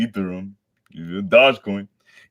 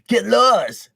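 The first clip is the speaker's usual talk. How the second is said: much higher, louder snapped line; hostile crowd; 8 dB louder than usual. Acted. Frightened crowd telling heroes to leave, snapped. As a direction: bite it out as a scared citizen.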